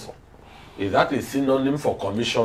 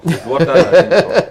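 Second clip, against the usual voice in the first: laughing the words out, higher and louder; 6 semitones higher, 8 dB louder than usual. Real, not acted. Panelist cracking up at the borehole story, laughing. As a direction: laugh through the punchline. Joking about the dry borehole.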